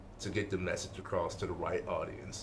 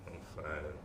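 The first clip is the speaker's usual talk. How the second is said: much softer and lower, flat; unconvincing "I'm fine"; 5 semitones lower, 11 dB quieter than usual. Shown as a demonstration. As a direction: say it like you don't mean it. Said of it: "If you tell me".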